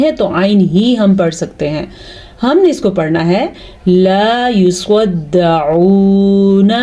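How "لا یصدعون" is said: لا یصدعون with a clear throaty عین, very slow; clear ain. Shown as a demonstration. Teacher models the ain sound for students, slowly. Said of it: "آئین کے تلفظ پہ خاص دھیان رہیں".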